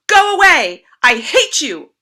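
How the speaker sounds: furious high yell; angry shout. A narrator's reading. An shouts at grandfather, furious shout. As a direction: scream it at him, pushing away. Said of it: "An shouted".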